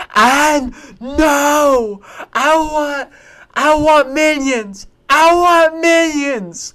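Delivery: put-on high, slow toddler whine; whiny toddler. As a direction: whine like a two-year-old wanting his toy. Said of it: "so he just goes".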